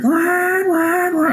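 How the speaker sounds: very high mock siren wail; imitated alarm. Alarms go off on the field, mock siren. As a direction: sound out the alarm blaring. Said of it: "it's like".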